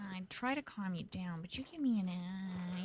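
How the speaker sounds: ordinary delivery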